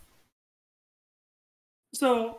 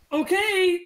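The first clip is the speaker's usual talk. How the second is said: impatient prisoner; 8 semitones higher, 5 dB louder, slower than usual. Acted. GM voices Gulliver.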